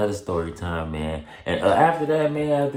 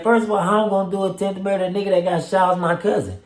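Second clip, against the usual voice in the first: fast, high, louder indignant protest; retold heated protest; 13 semitones higher, 6 dB louder than usual. Arguing with the officer, fast high indignation. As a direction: rattle it off high and fast, outraged.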